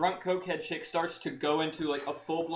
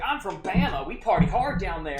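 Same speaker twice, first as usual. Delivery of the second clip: loud mock Alabama accent; 3 semitones higher, 6 dB louder than usual. Drunk woman announcing where she's from, mocking Alabama accent. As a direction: put on a rowdy Alabama party-girl accent. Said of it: "she was from Alabama".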